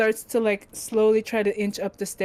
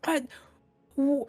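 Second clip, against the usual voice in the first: high, swooping question to a god; pitched-up, inquisitive voice; curious questioner; 6 semitones higher than usual. Acted. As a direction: call up to the creature with bold curiosity. Character questions the snail god.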